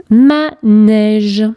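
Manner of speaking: "manège" slowly split as ma, nège; syllable by syllable. Shown as a demonstration. Teacher correcting a worksheet, syllable by syllable. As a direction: sound out "manège" in two held beats.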